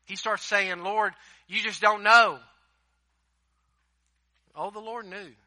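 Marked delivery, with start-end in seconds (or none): 0.8-2.4 loud excuse. Retold or imitated. Gideon's excuse to God.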